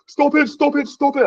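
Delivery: high shrill-pitched mock yell of stop it; race organizer yelling. French race director on the road yelling at riders, high mock shout. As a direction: wave riders down, high and urgent. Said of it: "in the middle of the road going".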